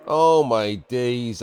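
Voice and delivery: drawn-out, slow groan of disbelief; slow despair. Real, not acted. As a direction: stretch it out in dismay. Despairing at the lost game.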